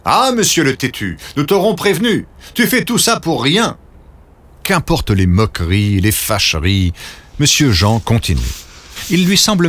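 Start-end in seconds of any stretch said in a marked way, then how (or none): 0.0-3.8 high, mocking scold; mocking angry villagers. A narrator's reading. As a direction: scold him, sneering upward. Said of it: "Certains de ses amis se fâchent".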